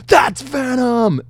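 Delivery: high, loud, slow shout of recognition; excited shout. Retold or imitated. Fan recognizing the hero in a theater, loud slow shout. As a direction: yell it out, thrilled. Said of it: "I go".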